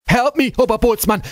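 high, loud plea for help; crying out. Acted. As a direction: cry out desperately to your chief. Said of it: "Franz cried".

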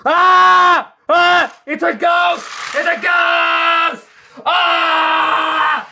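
high, loud panicked shrieks; loud high screams of a scared engine; terrified screaming. Acted. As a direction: scream over and over in fright. James frightened by Diesel's prank.

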